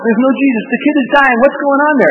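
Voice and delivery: high and loud, frantic; frantic Peter. Pretend. Peter at the house calling out, high loud frantic. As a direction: panic about the dying kid. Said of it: "who went".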